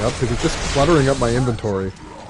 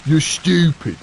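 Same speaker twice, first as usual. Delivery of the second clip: louder mock British bloke, boasting; cheeky British lad showing off; hammed-up British argument voice; mock British banter; 3 semitones higher, 4 dB louder than usual. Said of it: "conversation between G-Wolf and G T F is like".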